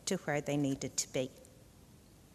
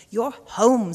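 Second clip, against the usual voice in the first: loud, high squawking crow; 6 semitones higher, 13 dB louder than usual. A narrator's reading. The crow squawks at the slugs, loud and high. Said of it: "squawked the crow".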